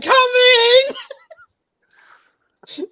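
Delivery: high-pitched mock yell, stretched out; imitated holler. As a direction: imitate someone yelling down the street. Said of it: "He's like".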